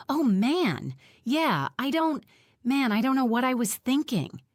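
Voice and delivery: alarmed. A narrator's reading. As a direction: let the alarm spill out. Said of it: "voice full of alarm".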